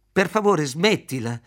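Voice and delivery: scared woman pleading to be let go; frightened plea. A narrator's reading. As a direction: beg him to stop in fear. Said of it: "I suoi occhi erano sbarrati per la paura".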